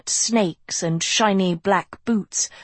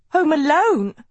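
high, loud stunned disbelief; as if just shot; shocked gasp; 9 semitones higher, 5 dB louder than usual. A narrator's reading. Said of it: "Sophie gasped as if she'd been shot".

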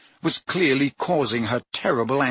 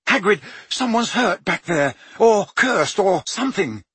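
higher, breathless alarm; urgent and alarmed; 6 semitones higher than usual. Acted. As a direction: blurt out the bad news. Harry urgently reports an attack.

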